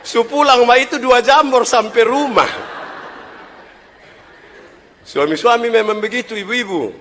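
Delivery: high, loud preacher's cry; fiery sermon voice; full-force preaching. Acted. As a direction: drive it home to the congregation.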